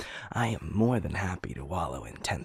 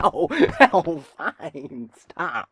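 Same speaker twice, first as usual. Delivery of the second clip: real laughter breaking through the ows; giggling ow, fine, stop; laughing protest; 10 semitones higher, 12 dB louder, much slower than usual. Real, not acted. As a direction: laugh while fending off playful hits. Being playfully hit, laughing.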